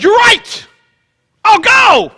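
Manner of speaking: high, loud sudden eager agreement; eager shout. Pretend. Moses suddenly shouting his eager yes. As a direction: blurt it out all keen.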